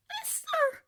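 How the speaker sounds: very high, whispery house-elf squeak; a tiny frantic elf pleading; Dobby voice. A narrator's reading. Tearful house elf begging Harry Potter, high and whispery.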